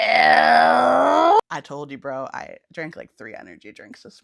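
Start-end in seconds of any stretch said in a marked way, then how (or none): 0.0-1.4 high, loud drawn-out wailing yeah; mock wail. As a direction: cry out the realization in a hammed-up wail. Imitating listener's realization.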